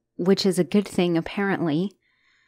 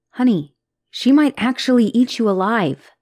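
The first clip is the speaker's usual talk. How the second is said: louder, insistent, punching might; urgent warning; 3 semitones higher, 8 dB louder than usual. Acted. Girlfriend insisting to partner that Lilith is dangerous, urgently. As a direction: say it out loud, insisting she means it.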